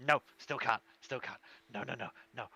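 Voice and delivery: a touch of fear, softening into a nervous mutter; shuddering refusal. Acted. Intimidated shopkeeper still refuses, a mild nervous tremble. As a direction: refuse while shivering under the threat. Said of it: "shudder a little bit and goes".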